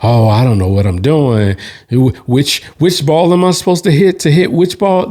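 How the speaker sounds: hammed-up confused novice act; pretending to be clueless. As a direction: play dumb, pitch climbing into confusion. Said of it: "I don't ever pretend like".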